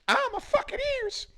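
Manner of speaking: very high squealed complaint; squeal of pain. Pretend. Friend complaining about the loud yell, high squeal. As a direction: shriek about your ears.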